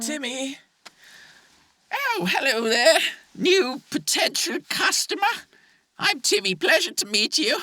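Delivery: put-on high, bright eager young man; chipper high voice. Butcher's meat man greets a customer, high and chirpy. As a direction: play it squeaky and overeager. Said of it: "Too happy".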